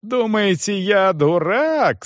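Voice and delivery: high-pitched, stretched-out outrage; indignant repetition. A narrator's reading. Young man challenges the manager, indignant. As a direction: drag out the angry question. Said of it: "снова повторял Гирш".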